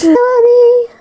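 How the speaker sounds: high, loud call to the pet; a sudden high loud baby-voice call to her pet; pet talk. Acted.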